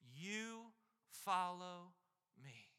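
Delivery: lingering, slow You follow; stretched, solemn command; drawn-out emphasis. Real, not acted. Emphatic close of point.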